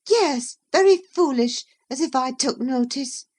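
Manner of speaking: high, loud peevish scoff. Acted. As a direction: sneer at it in a high, cross voice. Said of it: "in a peevish tone".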